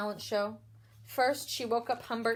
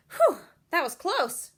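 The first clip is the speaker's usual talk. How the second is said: relieved whew; 5 semitones higher, 7 dB louder than usual. A narrator's reading. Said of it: "she thought".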